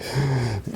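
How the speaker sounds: imitated sharp gasp; quick, soft mimed gasp; sharp inhale. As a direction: suck in a quick breath, acting it out. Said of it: "he, like, inhales sharply".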